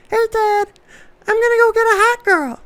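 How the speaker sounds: put-on very high, fast squeaky toddler voice; high little kid bragging to his dad. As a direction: chirp it like a cocky little boy. Son's toddler quote in toy car.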